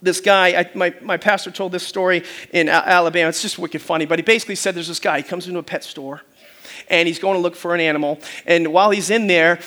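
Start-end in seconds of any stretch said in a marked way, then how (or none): none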